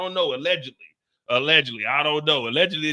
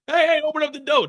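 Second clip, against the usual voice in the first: banging at the door; 7 semitones higher, faster than usual. Retold or imitated. Someone outside needing to pee.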